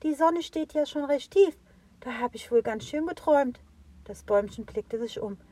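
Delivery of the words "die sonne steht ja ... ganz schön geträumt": put-on high, bright childlike tree; little tree voice. A narrator's reading. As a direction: speak as the cheerful little fir tree.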